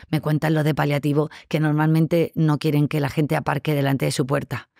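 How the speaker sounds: usual speaking voice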